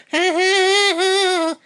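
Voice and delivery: high, loud cackling laugh; mock cackle. Imitating Jean laughing at the cats, high loud cackle. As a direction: cackle shrill and loud in mockery. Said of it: "she'd be like".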